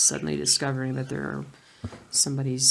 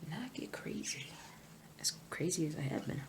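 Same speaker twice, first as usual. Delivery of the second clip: whisper; 15 dB quieter than usual. Real, not acted.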